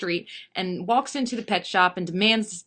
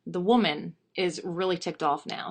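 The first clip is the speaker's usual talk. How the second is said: plain reading voice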